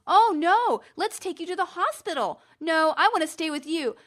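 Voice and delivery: hammed-up picture book characters; voicing a storybook engine. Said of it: "I always try to add a little bit".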